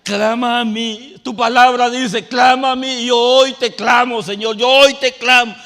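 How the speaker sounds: loud, high crying out, slow; shouted prayer. Retold or imitated. Preacher reenacting how he shouted his prayer on his knees, loud and high. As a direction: shout the promise back to God. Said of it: "Yo empecé a gritar".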